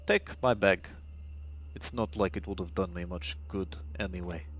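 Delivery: low, quiet doctor voice, halting between phrases; weary underground doctor. Acted. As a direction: speak low and deliberately, resigned.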